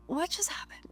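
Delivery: high, very soft disbelieving question; stunned hushed aside. Acted. Person caught off guard in a tense moment, hushed disbelief.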